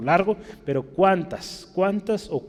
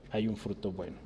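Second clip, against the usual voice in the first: soft echo; 5 semitones lower, 11 dB quieter than usual. Acted. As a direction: repeat "hay un fruto bueno" much softer, a quiet echo. Eco suave de la frase. (eco suave, casi murmurado)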